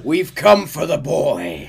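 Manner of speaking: loud high slow bellow; shouting. Acted. Dutch bellowing at the mansion for the boy. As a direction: call the enemy down from the mansion. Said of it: "Dutch shouts at the mansion".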